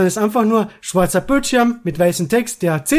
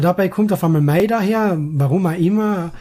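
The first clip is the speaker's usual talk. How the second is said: ordinary talk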